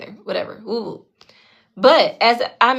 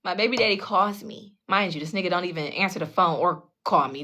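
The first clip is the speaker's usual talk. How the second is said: normal talking voice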